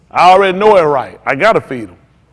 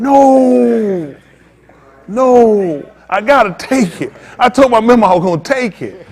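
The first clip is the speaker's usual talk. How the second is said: higher, louder insistent no; mock stubborn refusal; 6 semitones higher, 4 dB louder, slower than usual. Preacher acts out refusing the offer to the congregation, mock stubborn refusal.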